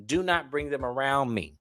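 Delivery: ordinary talk, nothing marked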